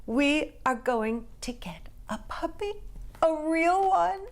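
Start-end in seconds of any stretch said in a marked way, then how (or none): 1.4-2.7 whispered like a thrilling secret; excited whisper. A narrator's reading. Girl confiding the puppy news in a whisper. 3.2-4.2 high, louder burst of glee; excited squeal. A narrator's reading. Girl thrilled about a real puppy, squealing. Gleeful reveal.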